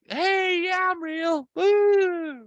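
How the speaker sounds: very high falsetto, showing off; mock woman's voice. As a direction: imitate the wife in a squeaky, playful falsetto. Imitates wife twirling.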